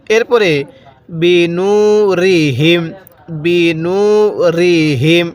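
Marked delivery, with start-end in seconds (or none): 1.1-5.3 slow, drawn-out tajweed chanting; teacher reciting slowly for students to copy; Quran recitation. Shown as a demonstration. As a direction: recite each syllable long and even. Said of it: "আমি যেভাবে উচ্চারণ করব".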